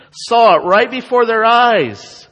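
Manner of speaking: high, loud proclamation; preaching shout. Real, not acted. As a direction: ring it out to the congregation. Preacher building to a climax.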